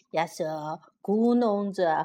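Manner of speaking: usual speaking voice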